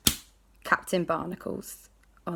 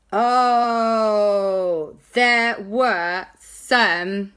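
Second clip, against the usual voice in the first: louder, very slow stunned oh; shocked exclamation; 3 semitones higher, 6 dB louder, much slower than usual. Acted. Jack reacting to the ghosts, stunned and slow. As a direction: stretch it out in shock.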